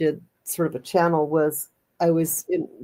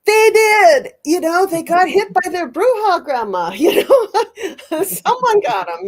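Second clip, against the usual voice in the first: laughing; 12 semitones higher, 7 dB louder, slower than usual. Real, not acted. Laughing about the bruja grandma.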